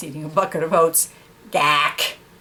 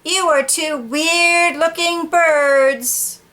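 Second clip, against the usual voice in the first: bird voice; 9 semitones higher, much slower than usual. Acted. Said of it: "And the bird says".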